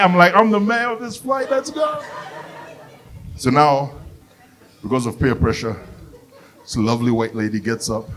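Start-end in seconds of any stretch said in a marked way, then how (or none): 0.3-2.0 very high, giddy squeal; boast, then trail up into a small high cheer; high-pitched party glee; gleeful boasting. Acted.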